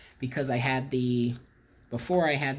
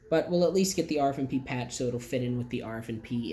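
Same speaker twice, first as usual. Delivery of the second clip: usual speaking voice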